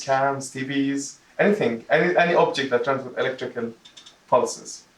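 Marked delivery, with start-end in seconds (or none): none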